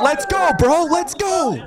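high, rallying cheer; real excited fan cry; hyped send-off. Real, not acted. Hyped sign-off to guest.

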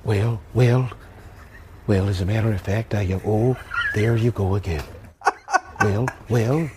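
low, halting elder-statesman voice; low presidential impersonation with measured pauses; Ronald Reagan. Acted. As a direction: drop low and stall on the wells. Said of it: "I gotta hear the Ronald Reagan".

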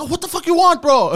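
high, loud startled yell; groggy angry yell. Retold or imitated. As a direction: snap awake and yell at her. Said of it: "I'm waking up like".

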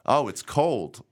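slow gasp dissolving into laughter; mock gasp, laughing. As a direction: act the cold shock, then crack up. Reading her reaction line.

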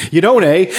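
mock Canadian, eh at the end. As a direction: tack on a Canadian eh. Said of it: "We don't really say it after everything".

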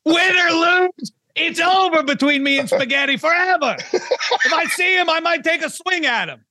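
half-laughing mock war cry; mock feud outburst. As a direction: swear off a rival in jest, laughing. Mock feud vow against Spaghetti.

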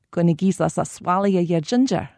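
put-on light broad Glasgow accent, words run together; broad Glaswegian. Guide showing tourists broad Glasgow slang, light Glaswegian accent. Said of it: "to give you".

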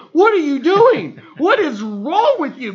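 high, loud, incredulous retelling; alarmed exclamation. Retold or imitated. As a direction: act out a loud, panicked scolding of your wife. Reacting to wife confronting a giant fan.

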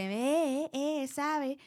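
light Aguascalientes accent, a touch sing-song; a hint of the Hidrocálido lilt from Aguascalientes. Shown as a demonstration. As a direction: slip into a mild Aguascalientes drawl on sabe. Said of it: "se me sale el hidrocálido".

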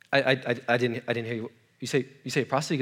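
a prophet who can't believe his ears; stunned stammering whisper-like mumble; stammering Hosea. Acted.

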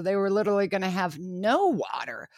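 ordinary talk

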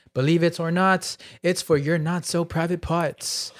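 measured, announcer-like sponsor read. Acted. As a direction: deliver it like a podcast sponsor shout-out. Reads sponsor ad.

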